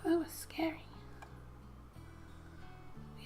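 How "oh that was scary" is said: ordinary delivery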